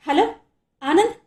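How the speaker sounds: flustered. Acted. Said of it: "గాభరాగా అన్నాడు".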